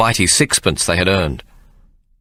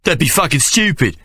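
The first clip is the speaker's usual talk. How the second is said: higher mocking street kid; jeering urchin; 8 semitones higher than usual. An urchin jeering at the boys, higher mocking voice. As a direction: scoff at the silly story.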